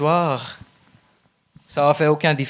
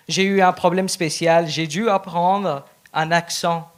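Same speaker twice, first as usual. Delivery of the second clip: usual speaking voice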